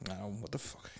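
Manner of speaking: hushed unvoiced whisper. Real, not acted. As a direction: whisper it like a secret. Whispered aside.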